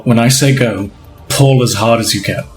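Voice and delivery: low, deliberate instruction; Matthias. Acted. Game master voices Matthias instructing Dill, low and deliberate. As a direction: drop the pitch for a steady order. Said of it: "Matthias is gonna say".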